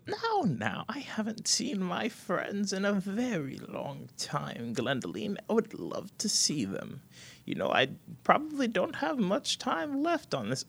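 put-on high, slow, soft old voice; wistful frail grandmother; old woman. Grandmother longing to see old friends, high and slow. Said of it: "the old woman goes".

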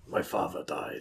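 whispered anime line; a hushed parody of anime grief; mock melodrama. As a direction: breathe it like tragic drama.

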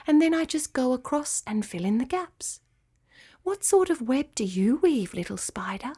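quietly encouraging teacher spider; kindly spider Nafila. Acted. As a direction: gently explain to a little one in a hushed character voice. Voices the spider Nafila in the story.

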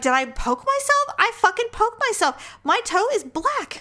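high-pitched retold disbelief at an injury; shocked realization. Host re-enacting discovering her fork-stabbed toe, shocked disbelief. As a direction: replay the dawning alarm, pitched well up.

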